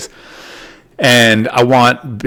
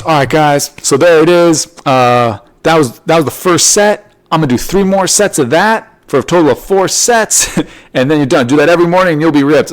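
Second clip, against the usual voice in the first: louder and higher, pumped fitness host; YouTube trainer; 7 semitones higher, 3 dB louder than usual. Retold or imitated. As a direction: sell it like an upbeat online coach. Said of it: "And he goes".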